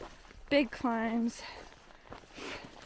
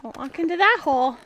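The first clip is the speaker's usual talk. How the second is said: louder, urgent warning; warning call; 4 semitones higher, 9 dB louder, faster than usual. Real, not acted. Warning hiker near hole.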